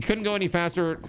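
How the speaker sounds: ordinary delivery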